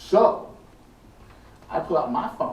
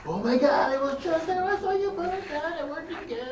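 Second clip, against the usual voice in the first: high-pitched, panicky retelling of the crowd; mock freaking out; 14 semitones higher than usual. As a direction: imitate a spooked tourist, lightly. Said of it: "everyone's, like, freaking out, going".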